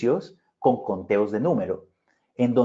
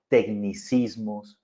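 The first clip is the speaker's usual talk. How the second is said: usual speaking voice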